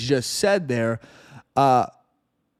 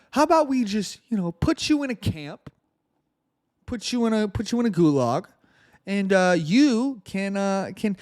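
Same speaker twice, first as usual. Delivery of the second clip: high-pitched, falsely casual proposal; mock suggestion; 6 semitones higher than usual. Host voices officials proposing camps to supporters, mockingly. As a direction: pitch it up like a breezy, sarcastic offer.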